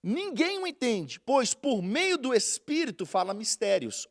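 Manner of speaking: slow, solemn Bible reading; deliberate preacher's reading voice; scripture reading. Acted. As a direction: read each word out to the church. Reads scripture.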